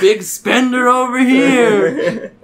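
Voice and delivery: high, loud, drawn-out tease; mocking big-spender jeer. Stranger ribbing him over the pricey hoodie, mocking. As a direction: crow it slowly over laughter.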